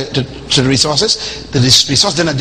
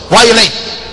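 nagging wife; 5 semitones higher than usual. Retold or imitated. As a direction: fire questions at him like a nagging wife. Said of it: "She goes".

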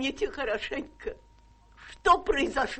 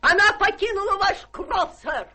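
high, laughing triumphant announcement; laughing triumph, rising higher; gleeful laughing reveal; 8 semitones higher, 3 dB louder than usual. Acted. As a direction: crow it out with laughter.

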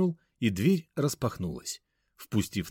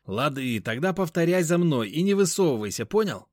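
put-on raised, bossy young man; bossy friend; 7 semitones higher, 4 dB louder than usual. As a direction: give orders, pushy. Venik gives orders, raised.

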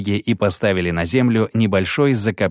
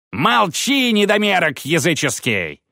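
much higher furious outburst; priest explodes; 9 semitones higher than usual. Acted. Priest explodes at the dwarf Zoltan, furious. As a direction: blow up at the dwarf. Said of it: "взорвался жрец".

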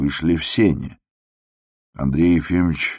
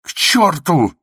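very high, loud wail; anguished shrieked curse; tearful shout; 14 semitones higher, 6 dB louder than usual. A narrator's reading. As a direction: scream it, close to tears. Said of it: "крикнул он плачущим голосом".

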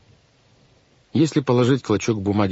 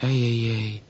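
ordinary delivery, nothing marked

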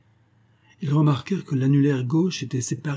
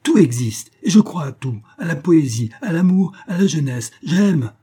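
much louder and higher, jubilant cry; 7 semitones higher, 6 dB louder than usual. Acted. Hermann pushes the professor away, crying out in joy. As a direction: shout your faith to the rafters. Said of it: "Hermann jeta un cri et ... s'écria".

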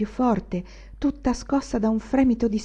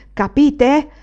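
Capite? louder and higher, voice flaring up; raising voice; 6 semitones higher, 8 dB louder than usual. A narrator's reading. Anania shouting at his mother, striking his chest. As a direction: throw Capite? at her loudly. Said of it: "ripeté sollevando di nuovo la voce".

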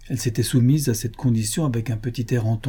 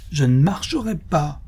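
put-on higher-pitched, piercing little-girl refusal; piercing child's cry; 5 semitones higher than usual. A narrator's reading. Little girl sat on the pavement defying father, piercing cry. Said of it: "de cette même voix perçante".

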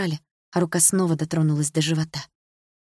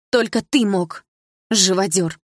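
angry accusation; 2 semitones higher, 5 dB louder, slower than usual. A narrator's reading. Daughter accusing her stepfather, angry. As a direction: spit out the insult.

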